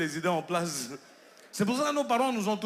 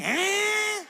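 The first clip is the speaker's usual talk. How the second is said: high sharp mock "Hein?" of an angry mother; mock scolding mother; 12 semitones higher, 4 dB louder than usual. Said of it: "elle te fait". ("Hein?" aigu de mère en colère)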